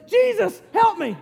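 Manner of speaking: high, loud acted shout; panicked cry for help. Sinking Peter calling to Jesus for rescue, high urgent shout.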